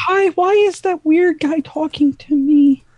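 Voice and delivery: high put-on voice; helium-like character voice. Cast member asking about the weird guy, helium voice. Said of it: "Risk doing that voice".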